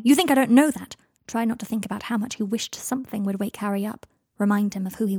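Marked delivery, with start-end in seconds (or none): 0.0-1.0 higher, louder cry; risen, unmoored voice. A narrator's reading. Draco remembering Harry's outburst, voice risen and unmoored. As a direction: let the voice rise, coming loose. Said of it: "Harry's voice had risen, unmoored, to say".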